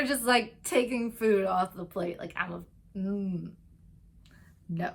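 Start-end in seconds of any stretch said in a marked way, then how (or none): none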